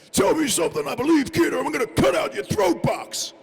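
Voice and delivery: high, loud hammed-up bellow of a threat; mock the other actors yelling the line way over the top; overacted screaming threat; over-the-top audition shout. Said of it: "I could hear some guys going".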